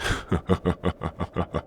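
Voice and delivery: soft, rolling gloating chuckle; quiet villainous laugh; mocking chuckle. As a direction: chuckle quietly at the prisoner's weakness. Captor mocks the hobbling prisoner.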